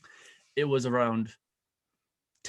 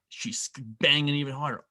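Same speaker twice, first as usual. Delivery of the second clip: ordinary talk, nothing marked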